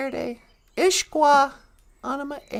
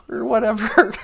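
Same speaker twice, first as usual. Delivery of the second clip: ordinary delivery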